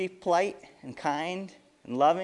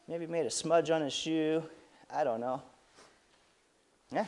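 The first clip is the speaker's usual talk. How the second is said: usual speaking voice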